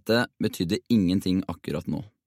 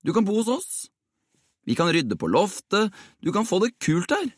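eager, much higher-pitched insistence; headstrong; 11 semitones higher than usual. A narrator's reading. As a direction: press the offer stubbornly, pitched high. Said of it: "sa Jonas egenrådig". (egenrådig og ivrig overtalende)

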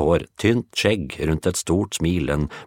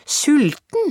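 very high, loud indignant echo; tiny boy; 5 dB louder than usual. Acted. The tiny boy answering Lise, squeaking with outrage. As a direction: squeak the word back in outrage.

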